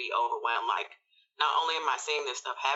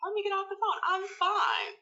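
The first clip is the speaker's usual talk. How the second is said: much higher, strained repeat; retold exasperated insistence. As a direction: insist you're fine in a high, tight voice. Same words repeated, now agitated.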